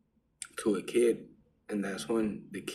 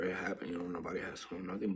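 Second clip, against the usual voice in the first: softer, fast, tossed off; hushed aside; 4 semitones lower, 10 dB quieter, faster than usual. Real, not acted. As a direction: keep it to yourself. Keeping it private.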